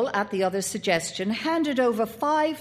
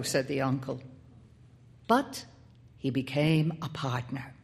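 plain reading voice

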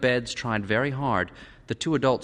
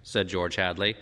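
ordinary delivery

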